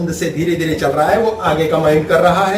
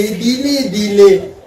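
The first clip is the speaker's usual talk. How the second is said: drawn-out, stretched words; very slow; much slower than usual. Shown as a demonstration. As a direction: let it crawl to show slowness.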